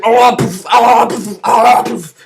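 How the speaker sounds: repeated groan with a puffed burst; jerky mock fit sounds; spasm noises. Man with full-body twitches, groaned puffing noises.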